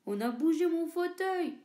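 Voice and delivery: lightly voiced big papa bear, loud; papa bear exclaiming. Papa bear exclaims about his moved armchair, lightly voiced. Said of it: "s'exclama papa ours de sa grosse voix".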